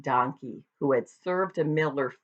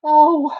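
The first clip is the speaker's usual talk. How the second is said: gasp; 12 semitones higher, 10 dB louder than usual. Acted. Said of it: "gasped the dog".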